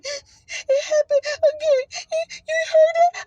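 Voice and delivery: high-pitched, breathless sobbing wail; crying. Retold or imitated. Wife telling her husband in the car what happened, crying. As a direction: re-enact a slow, overwhelmed tearful squeal. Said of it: "I'm crying".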